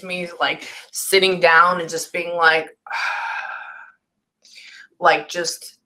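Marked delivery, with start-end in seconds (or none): 2.9-5.8 sighed whisper. Real, not acted. Medium softly describing spirit's slowing down.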